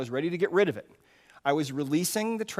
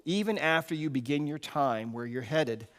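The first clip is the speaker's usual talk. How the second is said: ordinary delivery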